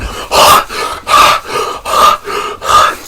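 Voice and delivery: exaggerated heavy gasps, drawn out; mocking hard breathing. Mocking the man in pain, exaggerated gasps. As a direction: mimic his panting to make fun. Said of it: "[name] was just mocking him".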